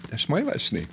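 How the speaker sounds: high-pitched imitation of a girl; young girl. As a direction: quote her in a small high voice. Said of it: "Young girl standing on the side".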